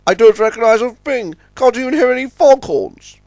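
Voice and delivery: high-pitched, fretful voice; anxious Top Hat. A narrator's reading. Top Hat is lost in the fog, anxious.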